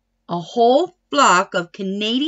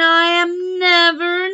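stubborn insist; 6 semitones higher, 4 dB louder than usual. A narrator's reading. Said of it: "said Tina".

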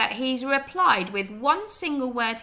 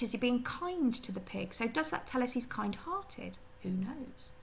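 ordinary talk

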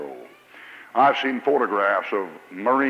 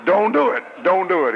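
pleading; 7 semitones higher, 3 dB louder than usual. Pretend. Preacher pleading with heartbroken girls.

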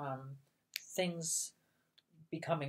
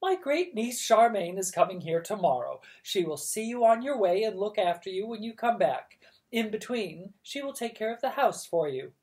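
bossy aunt; 6 semitones higher, 6 dB louder than usual. Acted.

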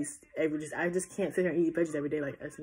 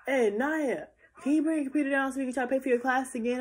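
mom voice; 7 semitones higher than usual. Retold or imitated.

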